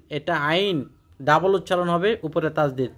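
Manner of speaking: ordinary talk, nothing marked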